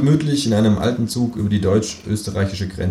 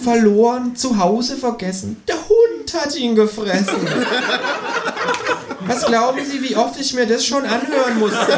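kangaroo voice, bossy. A narrator's reading. Känguru bosses the policeman, high and fast. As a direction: order him around in the high kangaroo voice.

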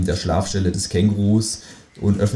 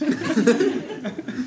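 high laugh; 4 dB quieter than usual. Acted. Kangaroo laughs at narrator.

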